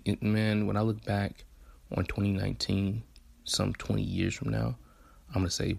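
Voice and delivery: ordinary delivery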